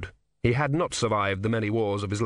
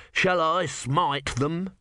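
mock biblical prophet; 5 semitones higher, much slower than usual. God offers to smite enemies.

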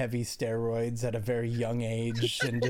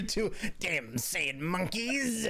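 lightly voiced cartoon villain, slow; hint of a villain impression; Frieza; 6 semitones higher, much slower than usual. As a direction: draw it out like a haughty villain. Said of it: "I'm doing the Frieza thing".

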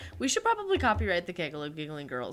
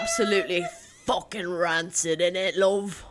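put-on laughing British accent, innit love; mock-posh British slang, giggling through it; 3 semitones lower than usual. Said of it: "British is the one that I prefer to do".

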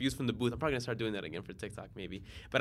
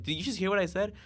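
alarmed friend; 9 semitones higher, 4 dB louder than usual. Retold or imitated. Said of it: "he's like".

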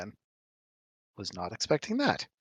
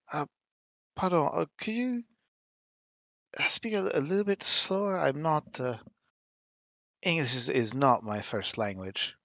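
put-on higher, halting French-accented English, dropping at the end; heavy French accent; 3 semitones higher than usual. [name] feigns poor English to Alina, heavy French accent. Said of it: "in very heavily accented English".

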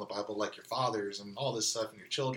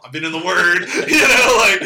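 emotional laugh; 9 semitones higher, 16 dB louder than usual. Real, not acted. Admitting he was emotional.

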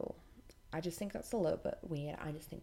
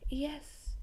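mock sweet yes; 10 semitones higher than usual. Mimicking preacher lady's answer.

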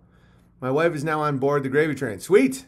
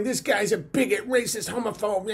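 a hint of a whiny tattletale, higher; mock complainer; 5 semitones higher, 4 dB quieter than usual. Mocking critics writing to DLive, lightly whiny. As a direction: sneer out the accusations.

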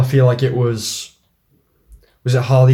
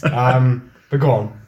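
ordinary delivery

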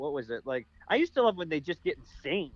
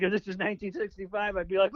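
ordinary talk

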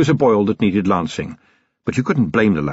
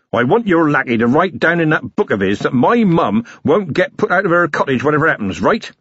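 higher-pitched, insistent villager; truculent blacksmith; 7 semitones higher than usual. Acted. Blacksmith demands his mum keep her cottage, truculent. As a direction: demand it as a stubborn working man.

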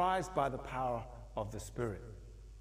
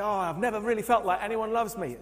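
higher, louder emotional outburst; a hint of a weeping congregant; tearful confession, acted; 9 semitones higher, 8 dB louder than usual. Congregant at the front confessing to the pastor, emotional. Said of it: "and say".

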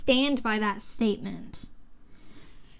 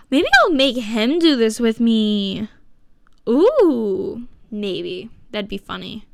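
normal talking voice

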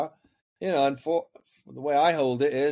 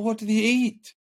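mother's voice; 8 semitones higher than usual. Retold or imitated. Mother stressed about feeding boys.

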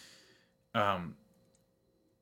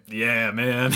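chuckling through a flat "yeah, man"; laughing it off while humoring him; laughing agreement. Real, not acted. Humoring a classmate, words broken by laughter.

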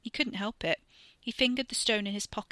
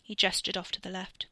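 ordinary delivery, nothing marked

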